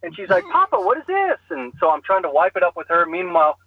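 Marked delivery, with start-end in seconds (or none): 0.5-1.4 put-on high-pitched, childlike question; little child. Two-year-old asks papa about the mess, little child.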